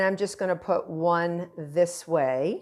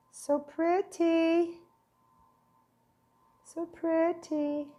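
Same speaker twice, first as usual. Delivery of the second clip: cooing so pretty; 9 semitones higher, 3 dB quieter, much slower than usual. Pretend. Admiring the finished tart.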